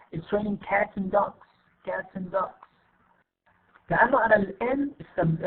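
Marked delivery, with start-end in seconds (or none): 0.1-2.6 AND shrunk to a quick 'n' joined to cats; reduced form. Shown as a demonstration. Teacher shows connected speech, AND reduced to 'n'. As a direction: run cats and dogs together, AND as a weak 'n'. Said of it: "we say just".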